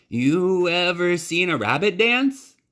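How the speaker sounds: put-on a nervous kid's sales pitch; showman boy. Bryce offers the dancing rabbit to Neil, high showman's voice.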